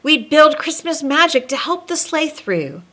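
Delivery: lilting read-aloud storybook voice; storyteller reading rhyming verse; story narration. A narrator's reading. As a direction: read it like a bedtime rhyme to kids. Reads rhyming Christmas story aloud.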